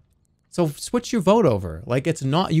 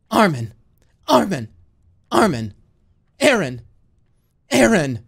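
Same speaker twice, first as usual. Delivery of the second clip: a hint of Mikasa; 3 semitones higher, 4 dB louder than usual. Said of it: "Do Mikasa".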